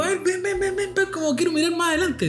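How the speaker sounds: put-on high eager child begging; a small child wanting to see; little kid voice. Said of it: "Entonces le digo".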